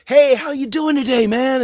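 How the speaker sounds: high, loud, hammed-up cheery hello; cheery greeting. Acquaintance greets a friend, high and loud with fake cheer. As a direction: play the upbeat guy who doesn't really want an answer.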